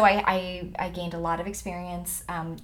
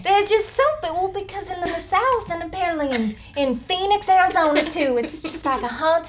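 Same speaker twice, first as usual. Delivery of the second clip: higher, louder Southern belle fussing over the heat; 9 semitones higher, 7 dB louder than usual. Pretend. Actress playing a Southern belle complaining about Arizona heat. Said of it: "Southern Belle".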